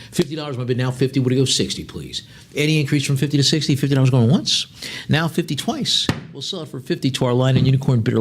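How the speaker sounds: rhythmic, rising auction chant, pushing for the next bid; lively auction patter; auctioneer bid call. Acted. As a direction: keep the bids rolling like an auctioneer. Auctioneer calling bids.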